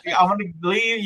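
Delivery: high-pitched mock whimper; whining Zenitsu. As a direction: whine like a crybaby begging to quit. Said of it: "just crying and, like, a-, and bitching".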